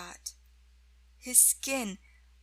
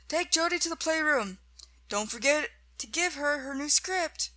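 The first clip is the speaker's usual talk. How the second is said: raised, louder instructions to a stagehand; calling out orders; 5 semitones higher, 5 dB louder than usual. A narrator's reading. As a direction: issue brisk orders across the set. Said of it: "calling a stagehand".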